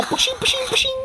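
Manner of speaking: high soft whooshing; spin sound effect. Pretend. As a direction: make the top whizzing sound. Voicing Beyblade spin sound.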